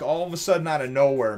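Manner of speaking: ordinary talk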